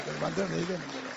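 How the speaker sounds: quiet final repeat. Real, not acted. Preacher to congregation, repeating it quietly as it fades. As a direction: let the repeat fall away quietly. Third repeat dying away.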